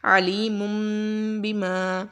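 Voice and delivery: عَلِيمٌ بِمَا with the ending ن said as a nasal م; careful Quranic reading of the ن as م; iqlab recitation. Shown as a demonstration. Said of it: "آواز کو ہم میم سے بدل دیں گے".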